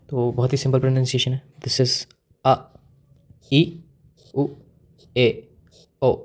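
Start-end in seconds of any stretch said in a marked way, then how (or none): 2.5-6.2 अ, ई, उ, ए, ओ as short, separate vowels with pauses between; pronunciation demo. Shown as a demonstration. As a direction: sound out अ, ई, उ, ए, ओ one by one for learners. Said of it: "इनका pronunciation देखना है हमें". (अ, ई, उ, ए, ओ का अलग-अलग, रुक-रुक कर उच्चारण)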